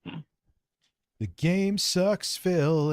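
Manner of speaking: a very slow, dragged-out mocking echo; parroting Phil's line back slowly in mockery. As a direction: stretch every word to tease him. Mocking Phil's last words.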